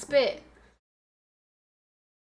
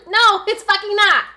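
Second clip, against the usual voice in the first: emphatic; 8 semitones higher, 9 dB louder than usual. Real, not acted. Rejecting a chat comment.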